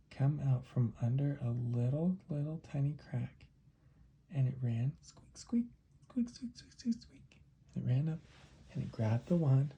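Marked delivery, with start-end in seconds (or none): 5.0-7.4 soft, high rapid squeaks; whispery high mouse chatter; scurrying mouse squeaks. Acted. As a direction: squeak along like a tiny running mouse.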